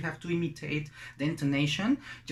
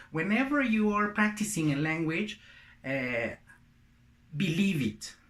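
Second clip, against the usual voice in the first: ordinary talk, nothing marked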